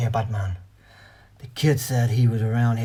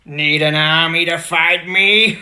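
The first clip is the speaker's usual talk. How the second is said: booming villain; 3 semitones higher, 8 dB louder, slower than usual. Acted. Villain taunting Hulk.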